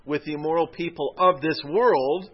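imitated disbelief, pushing up in pitch and volume; incredulous. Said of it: "[name] speaks pretty incredulously here".

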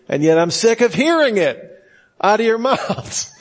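high-pitched mock exasperation tipping into laughter; laughing complaint. Real, not acted. Speaker jokes he is sick of church jargon, laughing complaint. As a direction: rise high, then crack up laughing.

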